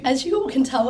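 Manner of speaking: ordinary talk, nothing marked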